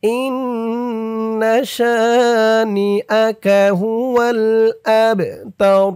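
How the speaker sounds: slow melodic tajweed chant; Quran recitation. Shown as a demonstration. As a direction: draw out every vowel in recitation. Recites Quran verses slowly.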